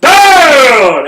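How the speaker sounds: loud, high barked command; drill sergeant yell. Retold or imitated. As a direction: bark it at the whole warehouse. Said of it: "I had the loudest voice".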